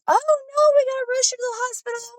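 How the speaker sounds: high, fast, frantic imitation of panicking; hammed-up panicked mother; mock panic. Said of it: "you know, panicking".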